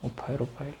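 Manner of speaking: very soft, downcast admission; dejected murmur. Acted. Man naming the great poet's worth in rupees, dejected and hushed. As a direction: let it drop away to almost nothing. Naming the pitiful price fetched.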